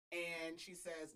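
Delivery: ordinary delivery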